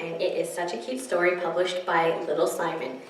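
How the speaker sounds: plain reading voice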